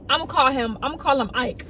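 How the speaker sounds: ordinary talk, nothing marked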